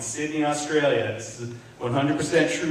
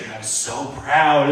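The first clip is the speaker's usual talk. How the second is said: ordinary talk, nothing marked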